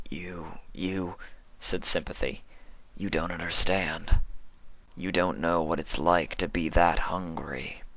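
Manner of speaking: hushed, hurting confession; Sympathy, pained. A narrator's reading. Sympathy voiced.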